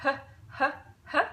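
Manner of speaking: plain English h breathed out; breathy h. Shown as a demonstration. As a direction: start from a simple h. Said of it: "you start by pronouncing an H".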